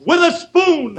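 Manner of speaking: high, proud punchline; excited. Real, not acted. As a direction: blurt out the gag proudly.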